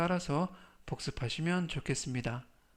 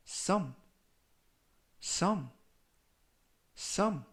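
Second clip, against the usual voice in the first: "sum" three times, long pause after each; slow model reading; 3 semitones higher, much slower than usual. Shown as a demonstration. Teacher reviews vocabulary for followers, slow model reading. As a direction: read out "sum" slowly for learners to repeat. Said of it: "천천히 세 번씩 읽어드리고요".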